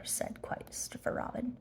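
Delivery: breathy whispered narration; barely voiced aside. A narrator's reading. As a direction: hush it right down. Narration tag, unvoiced.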